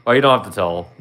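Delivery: usual speaking voice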